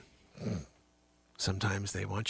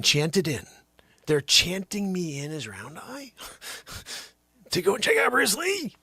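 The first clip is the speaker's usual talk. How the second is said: ordinary talk, nothing marked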